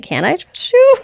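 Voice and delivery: high, squeaky little question; exaggerated high-voiced query; mock doubtful question. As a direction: pitch it way up, comically unsure. Voicing heroine's doubtful question.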